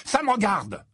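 high, heated comeback; furious retort. A narrator's reading. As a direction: answer back in anger. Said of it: "répliqua Ron tout aussi furieux".

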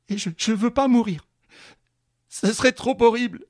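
high, loud terrified plea; trembling voice. Acted. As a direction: beg not to die, voice quavering. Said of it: "répondit d'une voix tremblante".

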